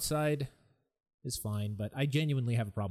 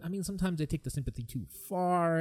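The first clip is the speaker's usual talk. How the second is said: ordinary talk, nothing marked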